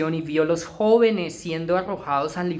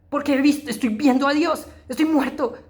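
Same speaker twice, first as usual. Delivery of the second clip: higher, shaken voice, calmer; fading dread; 6 semitones higher than usual. Acted. Isaiah after seeing God, still high and fearful. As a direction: let the terror settle but stay shaken.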